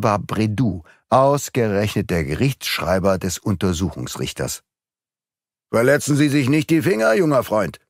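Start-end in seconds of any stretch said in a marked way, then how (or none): 5.7-7.8 quick, higher-pitched taunt; mocking. A narrator's reading. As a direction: sneer with false concern. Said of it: "spottete Brédoux".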